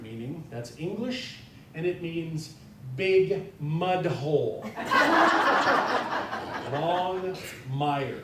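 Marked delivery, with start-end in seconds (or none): 4.7-6.7 loud high burst of laughter; big laugh. Real, not acted. Laughing out loud at the name meaning big mud hole. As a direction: crack up at the joke.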